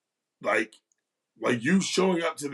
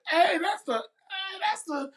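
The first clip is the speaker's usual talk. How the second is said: excited recognition; slower than usual. Retold or imitated. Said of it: "everybody's like".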